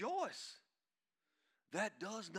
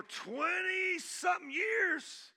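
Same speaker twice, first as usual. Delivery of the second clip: slow, loud, high preacher cry; drawn-out pulpit shout; stretched preaching shout; 12 semitones higher, 7 dB louder, much slower than usual. Acted. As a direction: draw the words out like a fired-up preacher. Preaching at full force.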